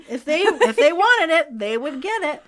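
high, loud amused burst; laughing. Real, not acted. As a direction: say it through a laugh, pitch jumping up. Laughs at absurd voluntary request.

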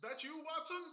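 distant, soft, from up on a roof; calling from far above. Acted. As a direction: call down from the rooftop, far away.

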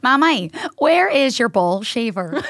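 slow, laughing attempt at Derry; attempted Derry accent. Shown as a demonstration. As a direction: copy a Derry accent, amused and deliberate. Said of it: "How's your Derry accent?".

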